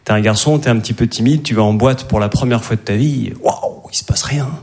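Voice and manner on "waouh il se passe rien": breathy letdown whisper, slow; disappointed whisper. Retold or imitated. Young man alone in the club, whispered letdown. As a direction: sigh it out like nothing happens.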